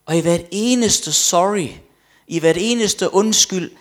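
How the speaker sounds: loud, high, emphatic retelling; genfortalt inderlig bekendelse. Retold or imitated. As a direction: cry out the retold confession, high and forceful. Genfortalt bekendelse med eftertryk. (højt, indtrængende genfortalt)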